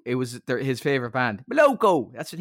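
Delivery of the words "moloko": put-on rural Irish accent. Friend imitating a Meath man naming his favourite band, rural Irish accent. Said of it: "fella from Nobber in County Meath".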